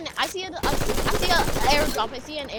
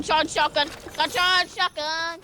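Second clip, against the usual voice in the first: ordinary delivery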